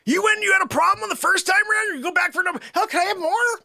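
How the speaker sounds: high, incredulous comic scolding; mock exasperation. Host scolds Joanne for going back, high mock exasperation.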